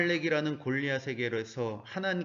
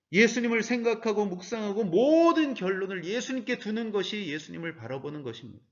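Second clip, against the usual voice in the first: ordinary delivery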